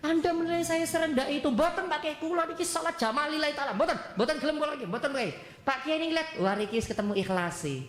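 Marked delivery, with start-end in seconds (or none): 0.1-5.3 high-pitched wounded protest; acted hurt, insistent refusal; indignant refusal. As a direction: refuse piously as if insulted, voice raised in pitch. Santri refuses, insulted.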